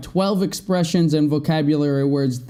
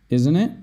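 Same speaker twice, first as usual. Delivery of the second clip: "isn't it" with the pitch climbing sharply on "it"; rising question tag. Shown as a demonstration. Teacher showing learners question tags, "isn't it" rising. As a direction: let "isn't it" swing up at the end like a real question. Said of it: "Note the intonation I use".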